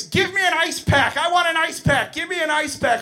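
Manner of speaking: put-on high, loud demanding child; screaming little girl. Teacher mimics a little girl screaming for an ice pack, high and loud. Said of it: "Little girl screaming in my face".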